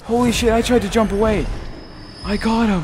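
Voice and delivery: high, loud, quick exclamations; adrenaline-filled victory cry; frantic triumph. Real, not acted. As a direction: shout in relieved disbelief. Narrowly wins a fight.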